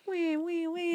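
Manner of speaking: high-pitched mock "wee wee wee"; playful high clown noise; high clown squeak. Mimics clown miming phone reply.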